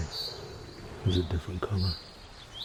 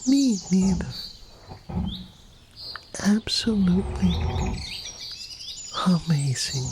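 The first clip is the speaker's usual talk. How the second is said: much higher, wide-eyed wonder; high awed girl voice; high amazed Sarah; 11 semitones higher than usual. Acted. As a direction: gasp at the glowing portal.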